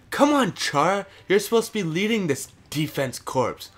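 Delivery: exasperated. Real, not acted. Calling out his defenseman.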